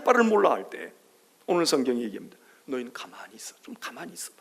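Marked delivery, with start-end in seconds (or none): 2.7-4.4 unvoiced 좀 가만히 있어봐, breath only; whispered hush. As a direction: lean in and whisper the command. God's hushing voiced in whisper. (숨소리로만 속삭이는 "좀 가만히 있어봐")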